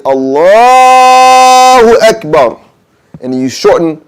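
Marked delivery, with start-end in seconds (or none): 0.0-2.6 Allahu Akbar with the vowel after the lam drawn out high and loud; lengthened Allah. Shown as a demonstration. Teacher shows the allowed long Allah, sung out high. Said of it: "but you can say".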